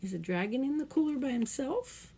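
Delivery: ordinary delivery, nothing marked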